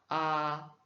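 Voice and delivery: "A" very open and loud, held; open mandarin a. Shown as a demonstration. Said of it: "mais un peu plus ouvert".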